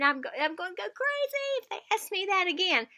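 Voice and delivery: high, loud mock frustration; frustrated. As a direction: vent like you're at wit's end. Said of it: "You might be saying".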